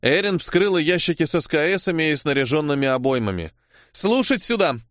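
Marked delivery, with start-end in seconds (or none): 3.9-4.8 higher-pitched call to attention; shouted order. A narrator's reading. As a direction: demand attention with a raised voice. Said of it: "крикнула чёрная жрица".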